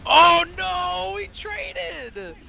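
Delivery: high loud shocked outburst that drops off; dismayed cry. Real, not acted. Realizing his unit traded shots, shocked cry.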